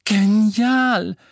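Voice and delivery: loud, high cry of delight; excited shout. A narrator's reading. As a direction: shout it out in joy. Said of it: "schrie Harry".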